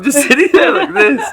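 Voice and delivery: breaking into high laughter mid-line; laughing. Real, not acted. To a friend recalling his pose, laughing.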